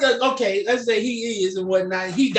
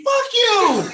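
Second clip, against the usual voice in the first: mock yell; 11 semitones higher, 3 dB louder than usual. Hero's rude exit line.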